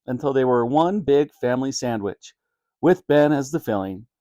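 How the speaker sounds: plain reading voice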